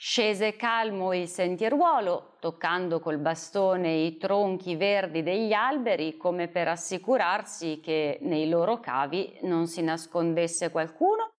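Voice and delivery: ordinary delivery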